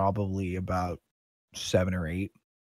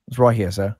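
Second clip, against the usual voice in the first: lightly voiced officer, louder; a louder, official check of the facts; guard captain; 6 dB louder than usual. Captain asking shopkeeper about the stolen item, a touch official. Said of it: "the captain of the guard goes".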